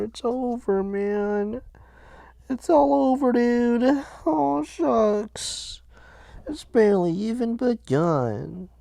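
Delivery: high, whiny mock lament; mock despair. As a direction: wail that it's all over, hammed-up. Mock despair after embarrassing sound.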